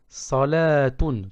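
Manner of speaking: salatun slow, the la held long; long second vowel. Shown as a demonstration. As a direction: stretch the la in salatun. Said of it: "qui signifie une prière".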